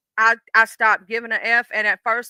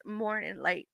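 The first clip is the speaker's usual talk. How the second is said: deepened voice; 3 semitones lower, 12 dB quieter, slower than usual. Pretend. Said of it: "I'm gonna try again".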